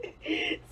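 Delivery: squeaky, breathless burst of laughter; high laughing fit. Real, not acted. Telling the boyfriend story, she breaks into a high laughing fit. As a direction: lose it laughing high and tight.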